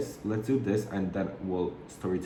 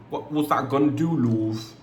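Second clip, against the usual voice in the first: higher, put-on British voice; playful British accent; 5 semitones higher, 4 dB louder than usual. Asking herself about lashes, playful British accent. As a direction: lightly ham up a British accent.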